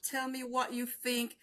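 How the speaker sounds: usual speaking voice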